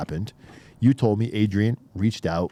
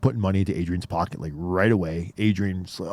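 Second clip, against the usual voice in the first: usual speaking voice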